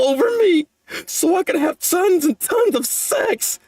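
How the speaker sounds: climbs from his own voice into a high, wailing sob; mock-weeping lament that builds as he admits it; overacted crying character voice; hammed-up tearful confession. Voices Denji's confession while reading.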